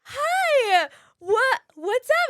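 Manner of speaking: high, loud eager greeting; too eager to seem casual; nervous starstruck hi. Retold or imitated. Young actor greeting Jennifer Coolidge again, nervous eager hi. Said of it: "I'd be like".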